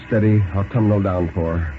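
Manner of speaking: ordinary delivery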